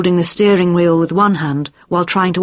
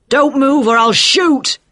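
shouting; 8 semitones higher, 3 dB louder than usual. A narrator's reading. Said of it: "he shouted".

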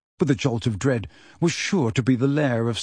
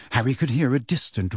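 ordinary delivery

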